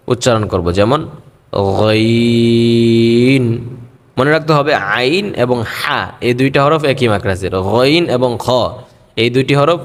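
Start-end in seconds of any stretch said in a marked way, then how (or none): 1.8-3.4 গইন drawn out slowly with a gargle in the throat; gargled ghain. Shown as a demonstration. Teacher showing students a gargled গইন. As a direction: rattle গইন in the throat, long and chanted. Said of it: "গলাটাকে একটু গড়গড় করে".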